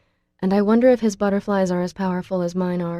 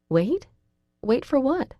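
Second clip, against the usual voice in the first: rising, puzzled question; baffled, pitch climbing; mild confusion; confused; 7 semitones higher, slower than usual. A narrator's reading. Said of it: "I ask in confusion".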